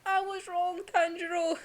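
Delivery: high, impassioned retold plea; Inosuke anguished. Retelling Inosuke refusing to kill his comrade, high anguish.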